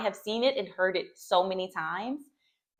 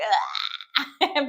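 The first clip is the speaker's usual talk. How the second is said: exasperated groan; 4 semitones higher, 6 dB louder than usual. Retold or imitated. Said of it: "and you're like".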